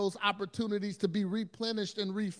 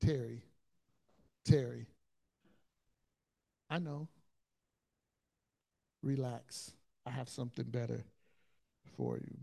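gentle calming; 6 semitones lower, 4 dB quieter than usual. Retold or imitated. Jesus calming stressed Terry, low soft tender. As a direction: settle him down tenderly.

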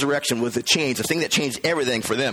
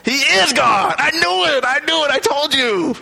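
triumphant excitement; 8 semitones higher, 3 dB louder than usual. Acted.

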